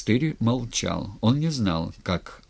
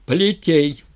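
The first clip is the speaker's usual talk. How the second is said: raised, loud order; commanding; 7 semitones higher, 8 dB louder than usual. A narrator's reading. Styr as tsar ordering the lash, commanding. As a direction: give a firm, high-pitched command. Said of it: "велел Стырь".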